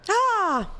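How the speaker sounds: sudden high yelp of relief; excited yelp. Real, not acted. Stream finally comes back.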